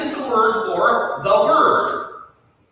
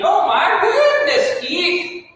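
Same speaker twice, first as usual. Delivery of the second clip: mock amazed student. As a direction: gasp it out as the shocked class. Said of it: "and you're thinking".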